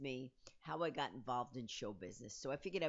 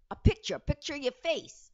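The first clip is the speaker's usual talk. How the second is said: loud, higher, impatient imitation; exasperated explaining; 9 semitones higher, 10 dB louder than usual. Casting agent to clueless newcomer, imitated loud exasperation. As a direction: spell out the obvious, loudly.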